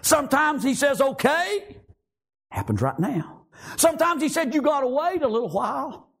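high, emphatic sermon points; impassioned preaching. Real, not acted. Preacher listing God's answers to prayer, impassioned. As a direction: pitch each answer high and firm.

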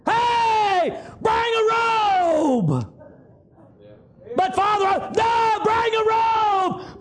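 high, slow, shouted commands; the joyful father shouts orders to his servants; father calling servants. Acted. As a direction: call out across the yard to the servants. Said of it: "called out servants".

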